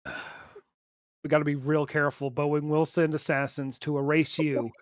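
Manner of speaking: low, hushed mock warning; joking paranoia, soft and low; mock-cautious warning. Mock warning about Boeing's assassins.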